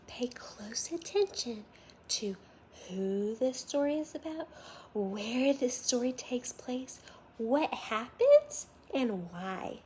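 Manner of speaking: put-on hushed, inviting teacher voice drawing kids in; storytime intro. Teacher inviting young listeners to pay close attention, hushed and inviting. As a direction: lean in and pull the class close.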